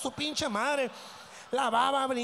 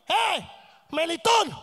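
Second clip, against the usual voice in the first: loud, high holler of a name; mock wife yelling at her husband; shouted summons; calling out; 4 semitones higher, 5 dB louder, slower than usual. Mother calling out to father, acted.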